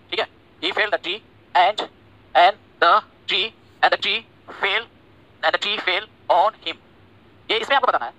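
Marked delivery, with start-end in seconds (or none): 0.6-6.8 "He felled the tree and the tree fell on him" dragged out word by word; very slow teacher dictation. Shown as a demonstration. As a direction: dictate it with long pauses. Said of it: "लिखा हुआ है".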